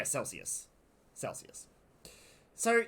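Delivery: normal talking voice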